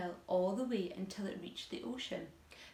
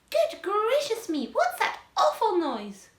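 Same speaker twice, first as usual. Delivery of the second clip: shocked aunt voice; 12 semitones higher, 10 dB louder than usual. Acted. Aunt startled by noise.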